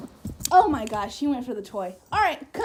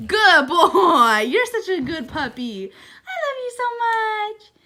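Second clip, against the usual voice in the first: loud praise melting into teary affection; gushing love; 4 semitones higher, 7 dB louder than usual. Real, not acted. Owner gushing over her puppy, near tears.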